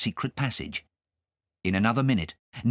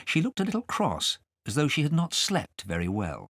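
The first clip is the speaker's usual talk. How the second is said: ordinary delivery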